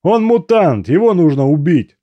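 loud and high, hard insistence; insistent shout. A narrator's reading. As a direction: shout him down. Comrade insisting on the kill.

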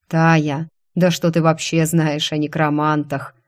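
plain reading voice

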